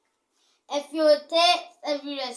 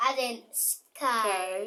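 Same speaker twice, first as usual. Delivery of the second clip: slow demo; 4 semitones lower, 4 dB quieter, much slower than usual. Shown as a demonstration. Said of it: "Say it with me".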